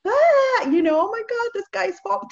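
high, squealing mock panic. Mocking people who panicked over covid, shrill mock panic. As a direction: lampoon the panicking crowd, voice shooting up.

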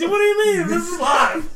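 mock outrage. Mock protest at restart.

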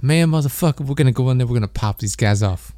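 lightly imitated slick street-talking action hero; a hint of a tough guy's cool swagger, half-joking; mock slick urban guy.

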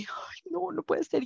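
high, soft mock gasp of awe; hammed-up breathless surprise; mock swoon. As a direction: gush like a smitten girl, softly and up high. Swooning at his tearful plea.

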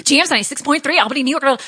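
put-on a touch of her old New England radio accent; imitating old radio accent. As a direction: rattle off the station ID like a young DJ. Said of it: "And I had such an accent".

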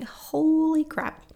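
high, loud, drawn-out exclamation; impressed amazement. Real, not acted. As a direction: stretch it out, marveling at the achievement. Host marvels at guest's degree.

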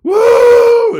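huge high shouted whoop; retold whoop. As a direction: let out a wild cowboy whoop. Cop firing his gun at dogs.

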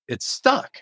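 high startled "It's stuck."; sudden puzzled push at the door; surprised. Retold or imitated. Door won't open, surprised.

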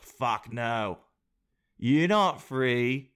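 put-on bullying British accent veering Australian; mock British. England scolding Canada for its brother, menacing mock-British accent. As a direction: refuse in a broad, bad British accent. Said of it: "British accent and not go Australian".

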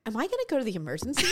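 a fast, rushed mock worry; retold panic. Retelling her own panicked thought after the sauce, fast. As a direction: rattle it off in a hurry.

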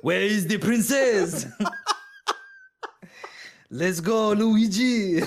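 high, loud, slow French Mario; French chef accent. Retold or imitated. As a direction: ham up a Frenchman calling Luigi.

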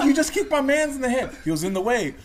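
high, loud, hammed-up outrage; indignant shouting. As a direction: yell in mock disbelief at the culprit. Confronting staffer who kicked friend.

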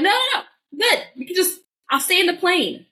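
high, hurried mock-panicked refusal; acted-out nervous babble; freaking out. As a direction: blurt it high and fast, then plead to back out. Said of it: "like, freaking out".

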